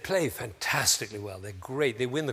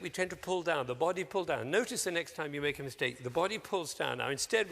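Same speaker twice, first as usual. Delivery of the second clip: usual speaking voice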